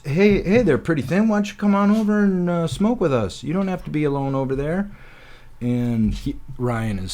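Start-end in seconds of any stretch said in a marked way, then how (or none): 0.1-4.9 loud, high, fast sleazy come-on; smooth buddy's pickup line. Retold or imitated. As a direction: call her over like a smooth player. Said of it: "he goes".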